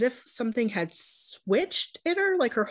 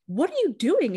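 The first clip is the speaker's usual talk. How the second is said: alarmed cry; 7 semitones higher, 4 dB louder than usual. Retold or imitated. Stops sister drowning kitten.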